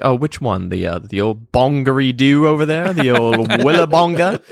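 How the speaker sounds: light put-on Australian slang voice, rising; mock Aussie. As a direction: tease with fake Aussie words. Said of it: "I'm like".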